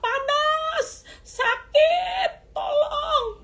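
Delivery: high-pitched loud cry for help; shouting. Retold or imitated. Preacher re-enacts desperate prayers to God, shouting. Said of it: "Ketika saya teriak".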